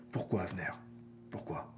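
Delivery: whispered, grave, with a heavy pause; mock-tragic whisper, letting each question fall; dramatic hushed reproach. Teacher's message to his pupil, dramatic whisper. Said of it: "vraiment un ton dramatique".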